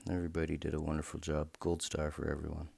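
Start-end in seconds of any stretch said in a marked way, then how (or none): none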